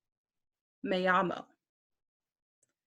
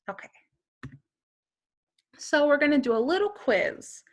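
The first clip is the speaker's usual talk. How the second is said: ordinary talk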